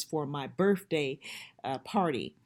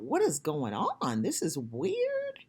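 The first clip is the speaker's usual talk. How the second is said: rising higher, slow and uneasy; replaying her spooked thoughts, slowed right down; scared inner thought; 6 semitones higher, slower than usual. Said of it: "I thought".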